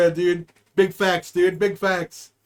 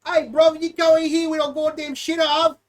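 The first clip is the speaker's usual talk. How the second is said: put-on loud, higher London-style accent; English accent; 5 semitones higher, 5 dB louder than usual. Doing a bad English accent for his friend's toilet joke. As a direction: bark it like a cockney inmate. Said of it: "do it with an English accent".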